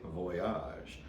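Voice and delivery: Voyage said the French way, vwah-YAHZH; soft French-accented Voyage; French pronunciation. Shown as a demonstration. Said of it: "or as the French say".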